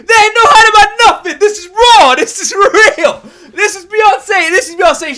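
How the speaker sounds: loud, high, laughing and half-crying; overwhelmed, laughing through it; overwhelmed laughing outcry. Real, not acted. Reacting to [name]'s video, loud laughing overwhelmed outcry.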